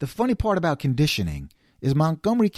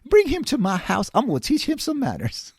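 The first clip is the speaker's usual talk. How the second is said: imitated Southern parent; 4 semitones higher than usual. Southern parents about their kids' friend Bruce, indignant Southern accent. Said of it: "I'm sure their parents were happy".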